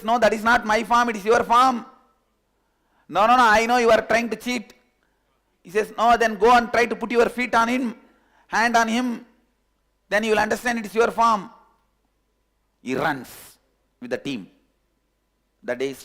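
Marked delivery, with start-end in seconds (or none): 0.0-4.7 loud, raised voice, pitched higher; heated argument. Acted. Two quarrel over the reflection in the pond, loud. 6.0-11.5 loud, raised voice, pitched higher; heated argument. Acted. Two quarrel over the reflection in the pond, loud.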